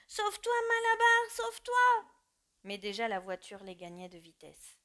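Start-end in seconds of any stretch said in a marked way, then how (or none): 0.1-2.1 high, loud desperate shout; the animals beg the horse to flee; crying out together. Acted. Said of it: "reprirent son cri".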